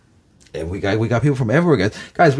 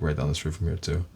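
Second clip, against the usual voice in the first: normal talking voice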